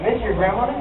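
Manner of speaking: ordinary talk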